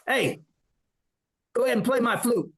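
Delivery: imitating the sergeant ordering a tune; sergeant's voice.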